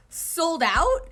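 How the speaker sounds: loud, high shocked "Sold out?"; shocked disbelief. Acted. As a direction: shriek the question in disbelief. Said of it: "Oikawa thought".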